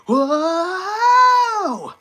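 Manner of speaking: long, high "whoa" scream; loud, sustained cartoon hero scream; Jason. Shown as a demonstration. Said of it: "I can do a good Jason scream".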